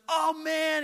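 high, loud delighted burst; excited exclamation. Retold or imitated. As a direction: throw it out with joy. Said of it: "this older gentleman, he goes".

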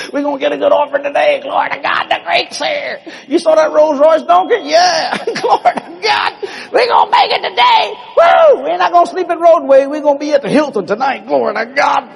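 high-pitched, giddy whooping celebration; hammed-up glee, rising into shouts; gleeful excitement. Acting out disciples thrilled about rich Greeks.

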